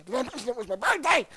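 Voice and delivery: ordinary delivery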